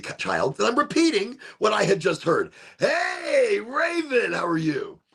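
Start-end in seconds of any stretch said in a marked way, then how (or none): 2.8-5.0 high cheerful hello; bright greeting. Real, not acted. Greeting a viewer named Raven in chat, bright and high. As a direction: call out a friendly hi.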